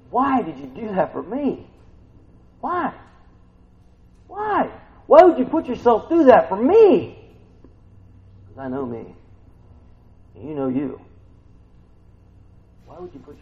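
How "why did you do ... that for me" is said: rising, louder, pleading questions building higher; pleading why. Pretend. Preacher voices a believer pleading at the cross, rising pleas. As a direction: plead with growing wonder, each why higher. Imagined plea to Christ.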